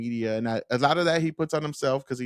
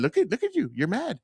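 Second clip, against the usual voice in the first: put-on childish taunt; 7 semitones higher than usual. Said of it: "child's play way of debating".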